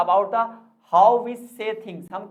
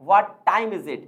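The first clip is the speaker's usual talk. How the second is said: "time" punched louder than the other words; stress on time; 3 dB louder, much slower than usual. Shown as a demonstration. Teacher showing word stress on the key word, stress on "time". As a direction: hit the word "time", leaving the rest light. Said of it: "time पर ज्यादा stress दिया गया है".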